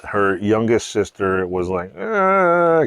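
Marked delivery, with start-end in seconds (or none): 2.0-2.9 high, loud mock wail; drawn-out toddler bawl, imitated; imitated crying. Telling how youngest sister was crying, mock wail.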